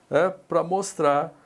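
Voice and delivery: ordinary talk, nothing marked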